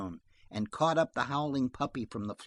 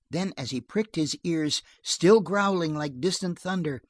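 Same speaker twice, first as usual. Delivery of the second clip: ordinary delivery, nothing marked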